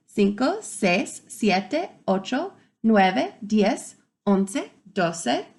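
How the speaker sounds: usual speaking voice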